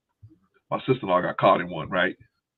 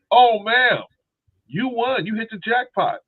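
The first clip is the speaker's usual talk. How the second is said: high, salesy mock voice of a phone scammer; 4 dB louder, slower than usual. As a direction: oversell it up high like a con artist.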